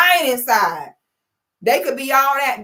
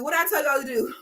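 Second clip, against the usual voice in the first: ordinary delivery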